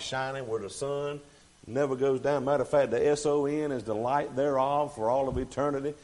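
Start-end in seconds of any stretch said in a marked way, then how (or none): none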